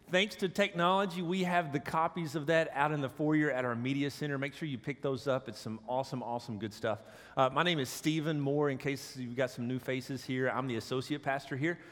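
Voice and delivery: ordinary talk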